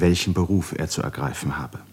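normal talking voice

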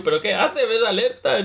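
light, teasing scold; mock telling-off. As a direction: nag a careless buddy. Scolding teammates who run ahead.

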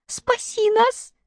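very high, slow squeak; little monkey squeaking for help. A narrator's reading. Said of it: "пискнула обезьянка".

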